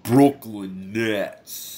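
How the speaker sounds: very slow, hammed-up Brooklyn accent; badly faked Brooklyn accent with a cold. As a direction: drag out each word in mock Brooklyn. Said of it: "my best Brooklyn accent".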